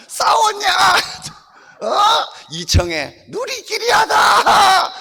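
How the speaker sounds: high, bold little puppet boasting. Acted. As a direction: brag proudly as the puppet doll. Puppet teases audience. (당당하게 자랑하는 높은 인형 목소리)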